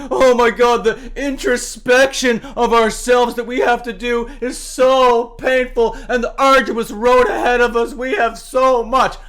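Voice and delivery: high, loud, dragged-out wailing parody; milk their self-pity for laughs; half-sung mock lament; sarcastic mock grief. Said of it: "if I sound super sarcastic".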